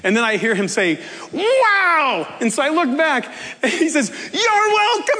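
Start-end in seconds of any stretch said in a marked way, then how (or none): 1.3-2.4 a high little cheer of surprise; amazed cook. Retold or imitated. Cook reacting to tip. 4.3-5.2 high bubbly call of thanks; cheerful cook. Retold or imitated. Cook calling after speaker.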